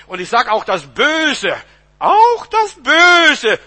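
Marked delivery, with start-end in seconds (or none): 2.0-3.6 high, very loud, drawn-out cry; stretched, soaring shout; emphatic preacher's shout. Real, not acted. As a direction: thunder it out slowly from the pulpit. Preacher repeats the phrase for emphasis.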